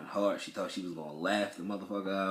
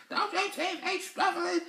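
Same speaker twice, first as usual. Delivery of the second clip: high-pitched laughing mock yell; teasing imitation of the wife yelling. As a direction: imitate her shrill yell through a laugh. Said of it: "She yelling".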